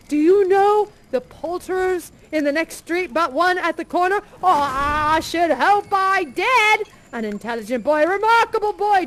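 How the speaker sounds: overjoyed to be alive; elated shouting Scrooge; ecstatic Scrooge. Acted.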